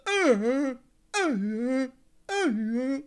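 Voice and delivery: high, repeated half-dead moans; mock high moaning; imitated suffering groans. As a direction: groan like someone badly hurt, mockingly. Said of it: "he was suffering".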